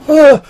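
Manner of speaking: high startled yelp of fear; whimpering flinch. Acted. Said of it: "he goes".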